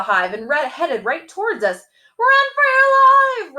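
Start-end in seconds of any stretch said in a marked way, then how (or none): 2.2-3.6 very high, loud panicked shout, slow. A narrator's reading. Robbie yelling to Freddie as bees swarm, panicked shout. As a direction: scream it as the bees chase you. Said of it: "Robbie shouted".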